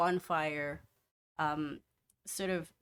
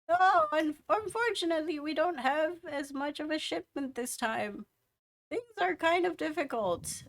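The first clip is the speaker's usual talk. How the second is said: put-on old woman voice; 8 semitones higher than usual. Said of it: "the old woman".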